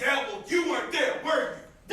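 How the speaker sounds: heated comeback in a fight; angry retort. Acted. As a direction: throw it back at him hard. Brother hits back in quarrel.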